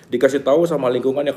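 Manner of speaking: normal talking voice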